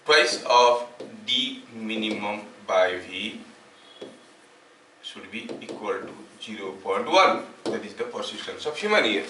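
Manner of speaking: slow, word-by-word dictation with long pauses; dictating notes. Acted. Teacher dictating a definition to the class, slow dictation. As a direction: dictate each phrase slowly so students can write it down.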